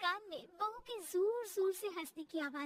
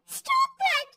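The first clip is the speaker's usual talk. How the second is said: high-pitched, louder angry cry; loud angry outburst; 12 semitones higher, 6 dB louder than usual. Acted. Daughter-in-law gives in to mother-in-law's pressure, angry outburst. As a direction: burst out with it, high and cross. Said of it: "जोर से गुस्से से बोल देती है".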